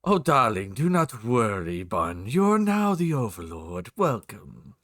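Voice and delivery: slow, grand, drawn-out diva voice; Edna Mode. Shown as a demonstration. Streamer welcoming the new overlord, slow imperious diva voice. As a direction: sweep through it slowly, like a theatrical designer. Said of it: "I can actually do Edna Mode's voice".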